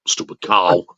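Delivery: low, muttered stupid cow; muttered insult. Retold or imitated. Captain muttering about the woman, low grumble. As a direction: grumble it under your breath. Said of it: "It's the way he does it".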